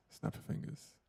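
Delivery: whispered mic check. Shown as a demonstration. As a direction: breathe it with no voice to test the gate. Mic test, normal then whispered.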